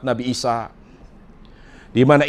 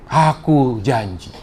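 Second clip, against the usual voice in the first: "Aku janji" drawn out very slowly, each word weighed; slow solemn promise; much slower than usual. Acted. Allah repeating his promise to Muhammad, slow and solemn.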